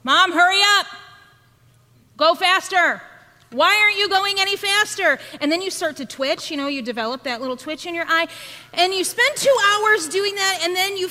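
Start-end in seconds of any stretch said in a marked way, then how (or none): 0.0-5.2 louder, higher mock kid yell; impatient child yelling. Youngest child yelling at Mom to drive faster, impatient. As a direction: demand to go faster like a bratty kid. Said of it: "that yells".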